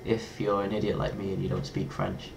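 ordinary talk, nothing marked

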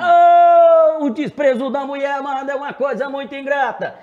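loud, high belted show call; show singer shout. Shown as a demonstration. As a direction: fire up the crowd, belt it. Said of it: "aí eu pego fogo".

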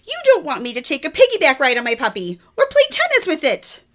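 lightly voiced pouting pigeon; sulky pigeon. Pigeon accusing the reader, a hint of sulk. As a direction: accuse them, hurt.

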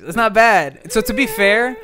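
ordinary talk

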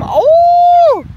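sudden high shocked cry; startled shriek. Real, not acted. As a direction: gasp out loud in surprise. Meeuw scheurt koekjes open. (verraste gil)

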